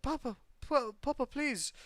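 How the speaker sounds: put-on girl's voice. Little girl voiced in story.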